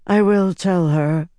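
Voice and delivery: slow, carefully even voice; controlled, level delivery; voice kept even. A narrator's reading. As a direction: hold the voice steady and measured. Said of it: "managing to keep her voice even".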